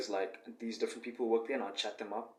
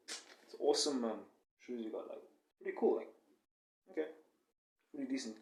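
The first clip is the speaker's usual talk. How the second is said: offhand compliment; 2 semitones higher, 4 dB quieter than usual. Shown as a demonstration. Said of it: "I have this awesome way of complimenting girls".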